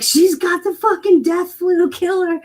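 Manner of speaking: a hysterical neighbor, hammed up; mocking neighbors. Mocking her paranoid neighbors, high and hysterical. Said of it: "they'd be like".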